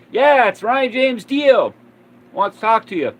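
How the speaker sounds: high and loud, bursting with the news; excited coworker. Retold or imitated. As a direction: announce it like it's unbelievable. Coworker announces famous caller.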